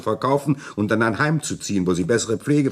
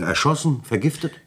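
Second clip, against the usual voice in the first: shocked questions; 7 semitones higher than usual. Acted. Shocked by news of murder.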